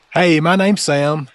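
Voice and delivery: ordinary delivery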